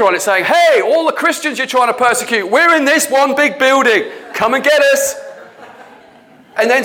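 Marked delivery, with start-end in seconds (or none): 0.4-5.1 a touch higher, hammed-up cheerful call; mock cheery invitation. Preacher lampoons a big church inviting persecution, mock cheery call. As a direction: lightly play a naive sign waving at persecutors.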